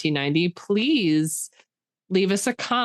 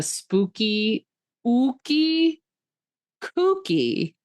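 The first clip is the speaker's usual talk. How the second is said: spooky voice; 5 semitones higher, much slower than usual. Acted. Spooky podcast sign-off wordplay.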